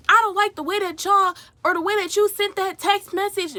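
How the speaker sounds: high, loud mocking yell; imitated yelling roommate. Mimicking roommate yelling about a text message. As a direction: shout it like the angry roommate did. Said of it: "she's yelling".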